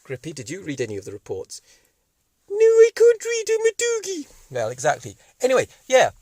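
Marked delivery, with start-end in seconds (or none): 2.5-4.3 very high, loud squeaky dog voice; a silly little dog answering back; high shrieky cartoon pup; daft dog puppet.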